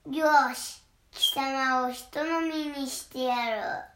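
loud, slow menacing troll. Acted. Troll threatens the little goat, slow and loud. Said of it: "とトロルが言いました".

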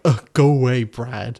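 loud exasperated "ugh", dropping off on the name; mock exasperation. Viewer grumbling at someone on a reality show, mock exasperation. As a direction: act fed up with a housemate. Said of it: "people on there that you're like".

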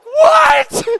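very high, loud squawk; mock tearful wail. Crying store worker answering a stranger, mock tearful wail. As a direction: imitate a sobbing girl snapping back. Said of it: "she's crying".

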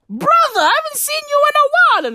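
very high, fast, squeaky bro voice; excited greeting. Acted. Jesus greeting Lucifer in hell, high and fast. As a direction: gush it like an overjoyed little brother.

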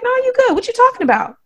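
high-pitched chorus of reassurance; high, dismissive imitation of pals; imitated friends. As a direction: mimic friends brushing it off, voice way up. Voices friends backing her up.

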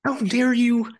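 high, indignant mock outrage; offended fan voice. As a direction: play an outraged anime fan. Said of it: "those are the kind of comments and message I would get".

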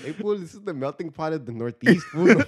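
hammed-up Jamaican patois cop, laughing through it; mock Jamaican patois. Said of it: "They should be patois".

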